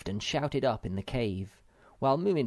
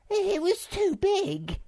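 very high, slow little-creature voice; innocent; 5 dB louder, slower than usual. A narrator's reading. Sniff excuses himself over the cake, innocently. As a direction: squeak it slowly, all innocence. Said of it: "said Sniff innocently".

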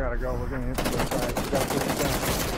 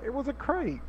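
mock whine; 11 semitones higher, 4 dB quieter than usual. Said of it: "and he's like".

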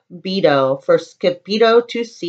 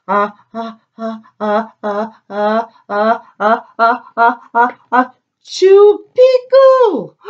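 building sneeze. Acted. The dog sneezes the secret password into the Chihuahua's ear, a building sneeze. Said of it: "half sneezed, half spoke".

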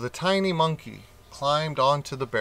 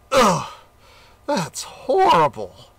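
loud, slow, choking yell; shout of disgust; 5 semitones higher, 5 dB louder, slower than usual. A narrator's reading. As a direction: warthog coughs on fire water, shouting disgust. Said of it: "he shouted".

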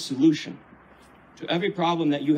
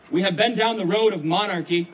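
usual speaking voice

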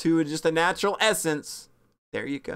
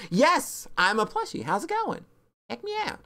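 lightly voiced cute plush toy, higher; plushie voice; 7 semitones higher than usual. Plushie of the streamer introducing itself, lightly voiced. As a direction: play the plushie chirping at viewers.

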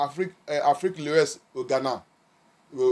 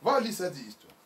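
ordinary talk

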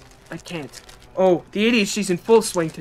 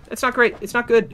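higher and louder, alarmed; not great, now more alarmed; 5 semitones higher than usual. Real, not acted. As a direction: let the worry break out. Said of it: "repeats the phrase, getting louder".

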